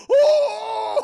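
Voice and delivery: very high loud shocked cry; very high loud mock shriek; freaked-out shriek. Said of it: "Ellie's like".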